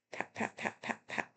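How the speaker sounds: soft whisper; whispered pats. Acted. Whispering pats while petting the good dude. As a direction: barely breathe the pats. Patting a dog softly.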